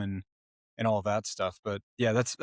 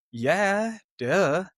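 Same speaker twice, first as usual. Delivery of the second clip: high, drawn-out "of course I can"; retold cocky eagerness; obvious yes; 7 semitones higher than usual. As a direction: answer like it's a no-brainer, pitched up. Acting out eager reply to lizard owner.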